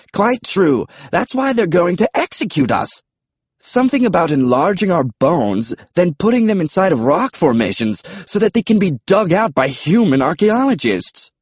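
high, British dinosaur voice; British pterodactyl, explaining. A narrator's reading.

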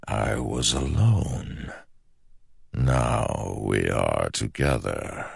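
slow, soft and hollow; slow ancient shadow voice. Acted. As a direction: speak like a patient shadow god. The shadow speaks.

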